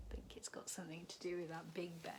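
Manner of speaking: soft unvoiced aside; quiet aside while moving the book; whisper. Real, not acted. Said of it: "whispered aside".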